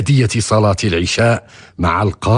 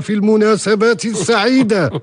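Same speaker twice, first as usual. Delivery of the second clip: lightly voiced judge's greeting, higher; imitating the judge; 9 semitones higher than usual. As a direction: quote the judge's hearty welcome. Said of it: "وقال لك". (تقليد صوت القاضي)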